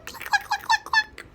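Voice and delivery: very high cluck sounds; flustered hen clucking; chicken clucks. Acted.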